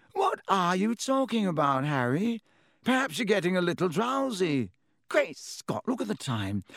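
high-pitched, bemused exclaiming; high astonishment. A narrator's reading. Lockhart is baffled by Harry's question, astonished. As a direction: marvel with theatrical surprise. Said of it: "in high astonishment".

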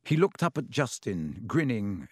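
plain reading voice

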